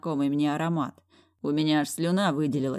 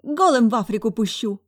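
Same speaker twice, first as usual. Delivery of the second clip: higher, loud threatening wife; barking ex-wife; 7 semitones higher, 8 dB louder than usual. A narrator's reading.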